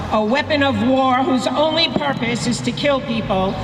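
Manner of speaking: ordinary talk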